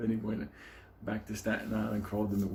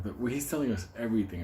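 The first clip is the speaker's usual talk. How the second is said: ordinary talk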